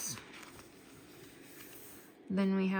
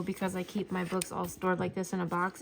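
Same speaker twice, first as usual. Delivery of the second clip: usual speaking voice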